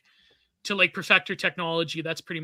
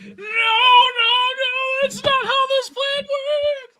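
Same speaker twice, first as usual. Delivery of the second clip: very high, drawn-out put-on wail; mock wailing protest; 6 dB louder, much slower than usual. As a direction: squeal it in hammed-up panic, stretching the words. Joking acted protest in the bit.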